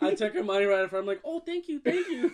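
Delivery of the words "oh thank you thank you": high, gushing mock thanks; mock gratitude. Brother acting out grabbing her money, mock gratitude. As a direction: pitch it up into a gleeful thank-you.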